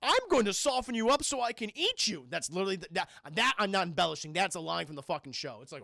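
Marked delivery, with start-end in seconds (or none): 0.0-2.3 menacing toucan. Retold or imitated.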